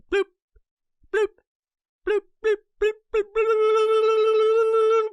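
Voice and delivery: high, loud bloop bloop of a phone; imitated notification bloops. Back in signal, imitating the phone going haywire with high bloops. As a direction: mimic a phone flooding with alerts.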